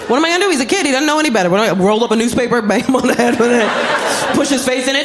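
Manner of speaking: high, fast, incredulous parental rant; exasperated rant. Acted. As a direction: rattle off absurd options in exasperation. Exasperated parent ranting about options.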